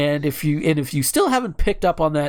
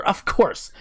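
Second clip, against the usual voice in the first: ordinary talk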